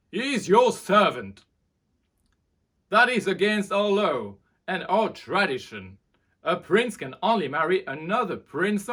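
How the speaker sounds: loud, high furious outburst; the king shouts down his son; angry king. A narrator's reading. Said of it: "He was angry".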